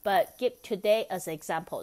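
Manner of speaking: ordinary delivery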